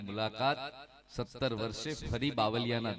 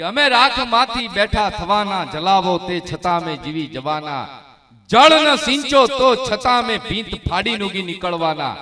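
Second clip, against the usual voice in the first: loud, high declaiming of a poem; poem recital at full force; 6 semitones higher, 14 dB louder than usual. Acted. Poet to the crowd, loud high declaiming. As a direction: ring the lines out to the crowd.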